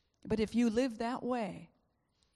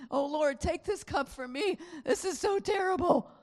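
high, strained pleading to the Lord; anguished plea; 9 semitones higher, 6 dB louder than usual. Acted. Jesus pleads with the Lord in high anguish. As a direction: cry out in anguish.

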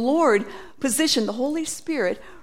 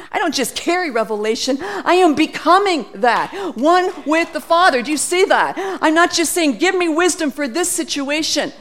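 full-force preaching; 4 semitones higher, 4 dB louder than usual. Real, not acted.

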